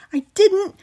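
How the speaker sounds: high, loud flustered denial; scared boy stammering. A narrator's reading. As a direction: scramble to defend yourself. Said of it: "Miles stammered".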